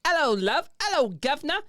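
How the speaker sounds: high, loud hammed-up Cockney; squeaky overdone London greeting; mock British accent. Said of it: "repeats greetings in put-on voice".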